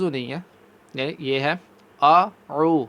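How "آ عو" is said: آ عو sounded as two different throat letters; hamza then ain. Shown as a demonstration. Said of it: "حمزہ اور پھر اس کے بعد عین،".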